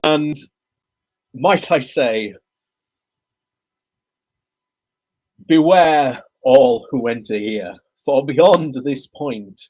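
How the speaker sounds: ominous slow carnival warning; spooky ride narrator. Pretend. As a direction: warn them like a haunted ride host.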